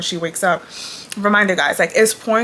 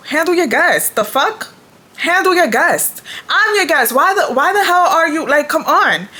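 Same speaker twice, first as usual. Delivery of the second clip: higher, louder indignant rant; 7 semitones higher, 3 dB louder than usual. Retold or imitated. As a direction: sound outraged at the host. Indignant retold complaint.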